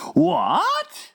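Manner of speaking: cried what. Acted. Giant hearing fromscottle is banned, shocked. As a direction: gasp it out aghast. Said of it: "cried the B F G".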